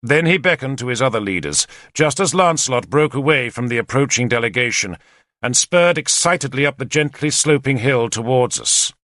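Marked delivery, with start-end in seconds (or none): none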